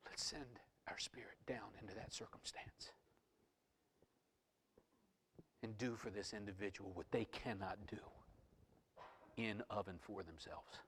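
soft voice with long pauses; quietly confide, as son to father; hushed intimate appeal. Acted. Acts Jesus speaking to the Father.

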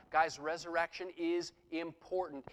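ordinary talk, nothing marked

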